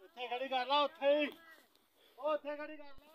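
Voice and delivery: high-pitched call across the yard. Real, not acted. Telling someone where to park, high distant call. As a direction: call out an instruction from afar.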